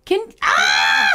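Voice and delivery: sudden high shriek; fright scream. Acted. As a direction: scream like the ghost jumped out.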